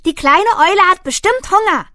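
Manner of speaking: hohe, laute Eichhörnchenstimme; Eichhörnchen ruft. Acted. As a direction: ruf es hoch und aufgeregt wie ein Eichhörnchen. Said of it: "Eichhörnchen daher und rief". (Eichhörnchen ruft den Tieren zu, hoch und laut)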